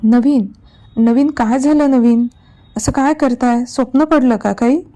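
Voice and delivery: urgent, worried calling of his name; alarmed wife. Acted. Wife wakes husband from nightmare. (घाबरलेली बायको नवऱ्याला हलवत जागं करते)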